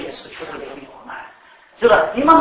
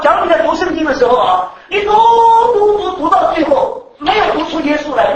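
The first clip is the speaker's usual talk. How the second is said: preaching at full force; 9 semitones higher, 10 dB louder than usual. Acted. Preacher to congregation on reading the Bible, loud sing-song. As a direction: chant the repeated word like a sermon climax.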